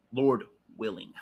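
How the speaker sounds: usual speaking voice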